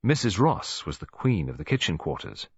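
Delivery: ordinary delivery, nothing marked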